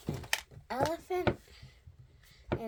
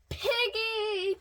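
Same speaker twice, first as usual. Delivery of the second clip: high, loud call to a friend; calling out loudly; excited shout; 8 semitones higher, 5 dB louder than usual. A narrator's reading. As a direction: shout the name out joyfully. Elephant calls to his friend.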